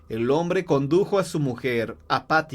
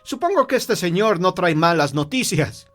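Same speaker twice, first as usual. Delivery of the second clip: higher, louder, urgent restraint; interceding husband; 6 semitones higher, 5 dB louder than usual. Acted. Husband cuts in on his anxious wife, urging restraint. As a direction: rein her in with raised, insistent voice.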